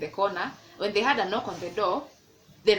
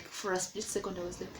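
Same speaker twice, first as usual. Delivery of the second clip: ordinary delivery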